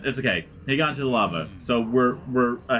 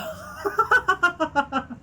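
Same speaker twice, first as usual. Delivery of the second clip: high-pitched helpless laughter; cracking up. Real, not acted. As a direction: burst out laughing at your own bit. Cracks up at own skit.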